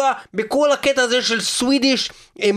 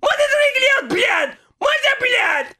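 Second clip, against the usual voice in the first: put-on higher and higher shouting in a Russian accent, cursing; furious Russian rant; 6 semitones higher than usual. Immigrant demon venting about everything, escalating Russian-accented shouting.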